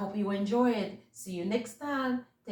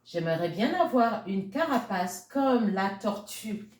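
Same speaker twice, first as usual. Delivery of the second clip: French story reading; 4 dB louder than usual. A narrator's reading. Reading the French version to children, lilting storyteller voice. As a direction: tell the tale to little ones in French.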